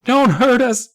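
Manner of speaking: put-on very high, loud little-kid wail; frightened wail. A narrator's reading. Small boy begging the old man, wailing. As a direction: wail the plea in a shrieky child voice. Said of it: "Nate wailed".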